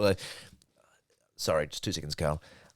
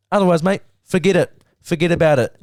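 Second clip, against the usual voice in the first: louder, higher mock threat; hammed-up tough-guy warning to the sponsor; 5 semitones higher, 7 dB louder than usual.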